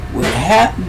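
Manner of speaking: plain reading voice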